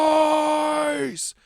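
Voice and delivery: loud commanding ogre call; booming deep command. A narrator's reading. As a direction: bellow it like a warlord. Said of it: "deep voice call out".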